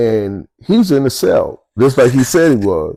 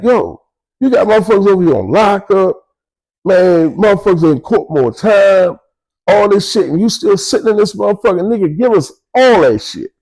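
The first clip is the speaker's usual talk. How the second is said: angry yelling; 8 semitones higher than usual. Retold or imitated. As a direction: lay into him and demand everything. Boo Man demanding the dope.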